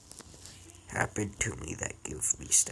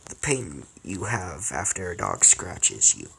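ordinary delivery

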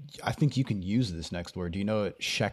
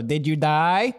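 imitated film line, louder, rising; mock movie quote; 4 semitones higher, 5 dB louder, much slower than usual. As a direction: toss out the movie catchphrase, higher at the end. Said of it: "the Hangover Two".

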